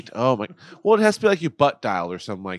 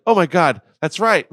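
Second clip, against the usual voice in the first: higher, louder sudden realization; mock realization; 6 semitones higher, 10 dB louder than usual. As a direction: catch yourself, louder. Remembering the open call.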